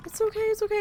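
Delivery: soothing imitated husband. Husband comforting his crying wife, mock soothing. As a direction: lightly mimic comforting someone crying. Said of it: "and he goes".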